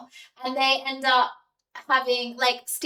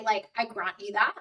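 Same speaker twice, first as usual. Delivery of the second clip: normal talking voice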